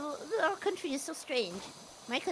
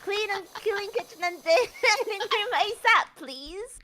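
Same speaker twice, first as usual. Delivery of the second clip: higher character voice breaking into a laugh; reading in his voice; 8 semitones higher, 4 dB louder, slower than usual. Acted. Host rereading the command politely in character, amused put-on voice. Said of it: "read out in his voice".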